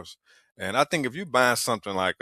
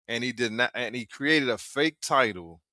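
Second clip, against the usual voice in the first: ordinary delivery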